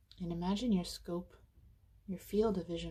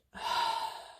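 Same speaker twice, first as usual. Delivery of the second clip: big open-mouthed exhale; demonstrated sigh of release; letting-go sigh. Shown as a demonstration. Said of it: "open your mouth and let it".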